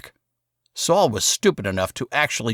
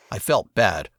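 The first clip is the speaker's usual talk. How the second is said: usual speaking voice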